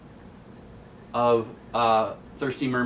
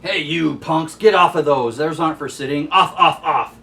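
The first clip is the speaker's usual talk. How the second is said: louder, higher scolding bark; angry shout; 5 semitones higher, 8 dB louder than usual. Retold or imitated. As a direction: chase the punks off the machines. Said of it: "here comes this laundromat Nazi".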